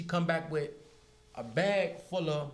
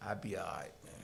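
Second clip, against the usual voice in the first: resigned and sad; 13 semitones lower, 12 dB quieter, faster than usual. Retold or imitated. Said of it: "sad as a motherfucker".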